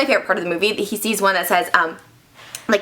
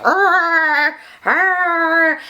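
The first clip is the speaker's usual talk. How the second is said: long, higher-pitched creature groan; monster roar; 5 semitones higher than usual. Acted. Imitating the escaped monster.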